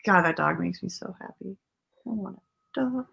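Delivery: ordinary delivery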